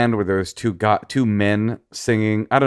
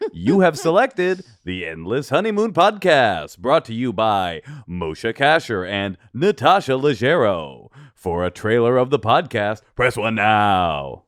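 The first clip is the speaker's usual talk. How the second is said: Movie Phone Guy. Acted. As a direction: read it like a recorded menu. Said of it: "I'm the Movie Phone Guy".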